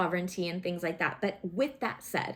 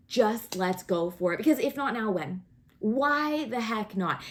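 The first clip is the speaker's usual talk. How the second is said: ordinary delivery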